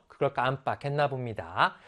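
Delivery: ordinary talk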